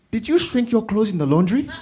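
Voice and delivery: high loud accusing mother; loud high skeptical mom; suspicious mom. Retold or imitated. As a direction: quiz him in disbelief. Mom suspicious about clothes.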